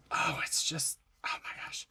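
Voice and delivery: hushed, voiceless admiration; breath-only marvelling; awed whisper. Real, not acted. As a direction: whisper it, lost for words. Overcome admiring friend's page.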